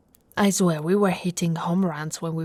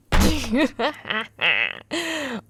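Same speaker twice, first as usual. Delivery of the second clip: light affectionate laugh; fond laugh; 4 semitones higher, 5 dB louder than usual. Acted. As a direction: laugh fondly at his joke. Laughs at partner's story.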